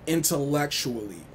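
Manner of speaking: ordinary talk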